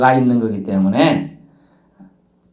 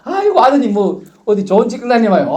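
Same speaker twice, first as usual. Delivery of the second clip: flattering evangelist; 8 semitones higher, 4 dB louder than usual. Retold or imitated. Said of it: "막 이렇게 미사여구를 하면".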